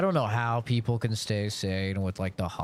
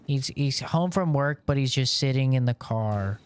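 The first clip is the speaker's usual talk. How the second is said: a lightly voiced wife, pitched higher and louder; mock wife; 7 semitones higher, 5 dB louder than usual. Worried wife filming her husband in the car, lightly mocked. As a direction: parody the worried wife posting online. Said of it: "and they're like".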